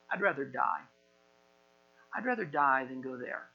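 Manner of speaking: low, soft, mildly sullen; sullen refusal. Acted. Jonah choosing death over going, sullen refusal. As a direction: drop the voice in stubborn resignation. Jonah would sooner die than go.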